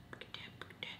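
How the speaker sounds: whispered self-calming. Real, not acted. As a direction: hush yourself, barely a breath. Calming herself under her breath.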